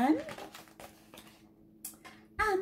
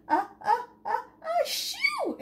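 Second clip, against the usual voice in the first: building higher ah-ah-ah into a sneeze; Dylan sneezing at the flowers; 12 semitones higher than usual. Acted. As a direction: wind up and sneeze. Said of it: "sneezed Dylan".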